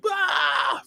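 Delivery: high yell, not full volume; imitated scream. Drill instructor screaming at new recruits, imitated scream. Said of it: "They start screaming right from the entry".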